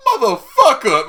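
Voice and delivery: high, loud comic outburst; mock outrage at being betrayed; mock angry yell. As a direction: yell it as a hammed-up curse. Falcon's angry retort acted out.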